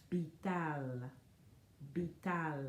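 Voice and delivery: vital with a soft lip b, bee-tahl; Vital, lips barely touching, beetal; soft bilabial v. Shown as a demonstration. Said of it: "It's bilabial like this".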